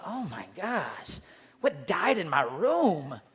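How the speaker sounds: hammed-up horror at a smelly room; mock disgust. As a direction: recoil at the stink, drawn out. Mock discovery of smelly socks.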